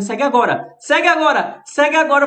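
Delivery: loud, high repeated plea; excited streamer calling out. Real, not acted. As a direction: shout at viewers to hit follow. Urging live viewers to follow.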